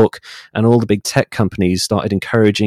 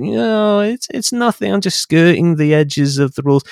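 pitched much higher, breezy and dismissive; mock the people brushing off the rules; 8 semitones higher than usual. Voicing careless rule-breakers' excuse.

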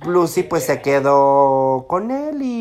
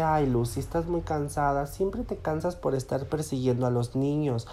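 soft, tender lover's murmur; tender sweetness; 9 dB quieter than usual. Retold or imitated. Client soothing his tired Lucy, soft and tender. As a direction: coo at her gently and sweetly, as he did. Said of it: "con un tono como se los estoy transmitiendo, así como, ay, sí, como dulzura".

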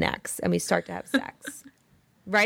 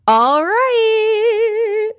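sing-song alrighty; 11 dB louder than usual. Real, not acted. Playful sing-song transition.

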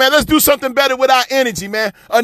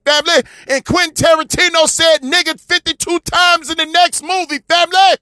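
building, higher and higher preaching cadence; rising preach; 7 semitones higher than usual. Real, not acted. Preacher rallying his audience, voice climbing higher. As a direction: whip the crowd up, pitch climbing.

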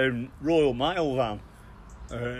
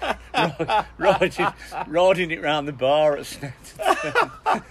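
words broken by real laughter, a bit louder; laughing while telling; 5 semitones higher, 4 dB louder, slower than usual. Real, not acted. Telling the bar story, laughing through it.

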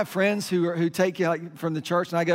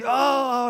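high, drawn-out "oh" of pain; imitated pained cry; 6 semitones higher, 3 dB louder than usual. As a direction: mimic a child's yelp as the bone is set. Imitates daughter as bone is pulled.

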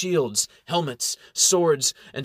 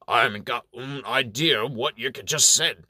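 hammed-up bad Cockney; terrible Cockney accent. A narrator's reading. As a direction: butt in with a badly faked Cockney accent. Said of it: "interrupts with his terrible Cockney accent".

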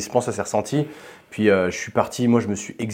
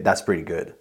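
put-on light American accent, lower and softer; standard American accent; 5 semitones lower, 5 dB quieter than usual. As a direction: quote it in a casual American accent. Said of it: "Et avec un accent américain".

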